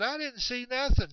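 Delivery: high-pitched emphatic denial; protesting. Retold or imitated. Chet answering excited DJ, protesting in a high voice. Chet's acted reply to DJ.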